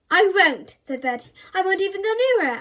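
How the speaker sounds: high, loud, quick protest; eager boy. A narrator's reading. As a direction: rush the promise out.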